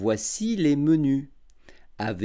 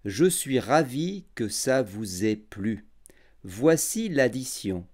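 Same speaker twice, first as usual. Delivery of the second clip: every syllable clear and unhurried; over enunciating; 5 semitones lower than usual. Shown as a demonstration. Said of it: "j'articulerai bien et je parlerai lentement".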